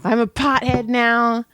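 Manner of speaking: normal talking voice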